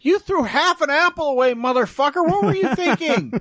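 high squeaky rat voice. Retold or imitated. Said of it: "He's like".

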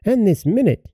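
high, scolding mother voice; insistent mother. A narrator's reading. Mother hurrying her daughter out, insistent. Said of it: "said the mother".

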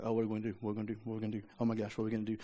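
low, hushed worrying to oneself; mock worried mutter; fretful muttering. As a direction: mumble it over and over like an anxious worrier. Acts out fretting over a problem.

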